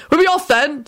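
high, brisk interjection; impatient. A narrator's reading. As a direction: cut in with mild impatience. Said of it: "said one of the attendants impatiently".

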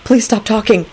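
rising, repeated begging; desperate high plea; pained pleading. A narrator's reading. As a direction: beg with pitch climbing. Pained inner pleading to stop.

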